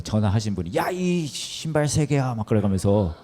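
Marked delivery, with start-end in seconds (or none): 0.8-2.3 drawn-out cursing, slightly raised pitch; restrained mock swearing. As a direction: imitate a caller swearing, stretching the words. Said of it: "갑자기 욕을 하기 시작하는 거예요".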